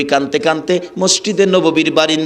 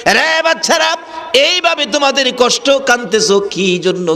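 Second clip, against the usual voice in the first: impassioned calling out; 8 semitones higher than usual. Acted. Prophet calls to two crying children, impassioned high call. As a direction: call out high, easing down as the question goes on.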